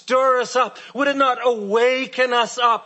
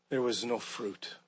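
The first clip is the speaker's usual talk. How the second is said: hushed dramatic drop; 11 semitones lower, 14 dB quieter than usual. Acted. Preacher stressing empty nets.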